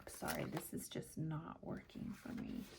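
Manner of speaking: soft unvoiced aside to herself; hushed muttered aside; whispered aside. Real, not acted. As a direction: whisper it under your breath in frustration. Muttered aside while fumbling stickers.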